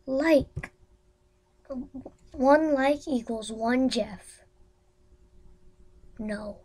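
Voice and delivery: ordinary talk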